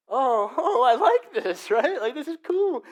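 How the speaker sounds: high, loud, laughing thrill; laughing delight. Retold or imitated. Kid on the roller coaster laughing with joy. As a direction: giggle as the fear turns to fun. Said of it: "turn into".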